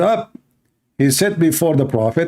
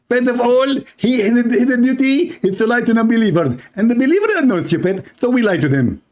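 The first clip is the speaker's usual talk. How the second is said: higher, put-on sneering voice of the opponent; 8 semitones higher than usual. Host mocks Basir's side in a higher put-on sneer. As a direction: parody the other side claiming lying is a duty. Mocking opponent's supposed view.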